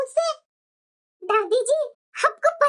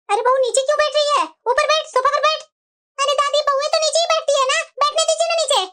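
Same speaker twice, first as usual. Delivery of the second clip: ordinary talk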